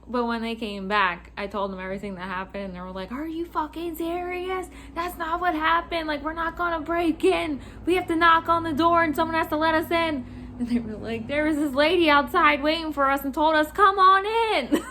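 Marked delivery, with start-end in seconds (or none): 3.1-10.2 high-pitched, incredulous protest; indignant denial. Retold or imitated. Coworkers denying they broke into a house, indignant. 11.3-14.8 loud, high retelling breaking into laughter; incredulous protest. Retold or imitated. Coworkers saying the lady told them come in, incredulous.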